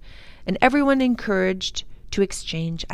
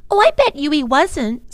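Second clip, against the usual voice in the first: put-on little girl Lindy; 9 semitones higher, 8 dB louder than usual. A narrator's reading.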